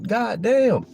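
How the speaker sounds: high, stunned god damn; a shocked cry, pitched high; stunned exclamation. Pretend. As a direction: gasp it out in disbelief. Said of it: "So it's like".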